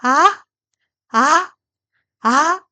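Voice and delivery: 아 climbing steeply upward; rising second tone. Shown as a demonstration. Teacher drilling second tone, rising 아. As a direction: push 아 up from mid to high. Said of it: "이 성은 올라가게".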